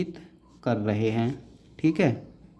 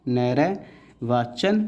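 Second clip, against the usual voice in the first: slow sounded-out word; much slower than usual. Shown as a demonstration. Teacher sounding out नैर्वाचन slowly for the class. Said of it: "निर्वाचन आयुक्त".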